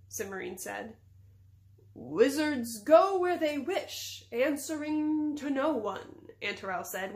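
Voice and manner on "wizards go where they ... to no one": slow, grand, higher and louder wizard voice; lofty. A narrator's reading. Wizard answering the princess, lofty. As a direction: draw it out loftily. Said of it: "in a lofty manner".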